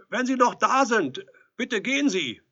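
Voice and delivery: high, loud dismissal through a door; calling out. A narrator's reading. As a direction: shout for the visitor to leave. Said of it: "rief Edelstein".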